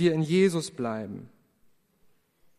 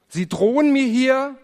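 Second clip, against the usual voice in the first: mock indignant cashier; 7 semitones higher, 6 dB louder than usual. Cashier accuses him of threatening.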